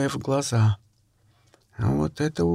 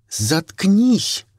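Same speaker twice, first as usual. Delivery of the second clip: angry; 4 semitones higher, 4 dB louder than usual. Acted. Snaps at Vika.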